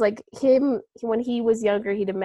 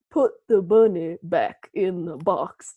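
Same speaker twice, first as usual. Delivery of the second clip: slow, lower Texan cowboy drawl; mock Texan accent; 3 semitones lower, slower than usual. Quoting Nick Cage's Con Air line in a mock Texan accent. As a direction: quote the line like a tough Texan cowboy, slowly. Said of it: "that accent was just so funny".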